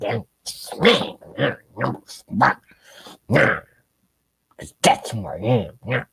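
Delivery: lightly voiced mocking politician; a hint of a vindictive bully's taunt; a mild mock impression of a spiteful speaker; Nancy Pelosi. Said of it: "Nancy Pelosi".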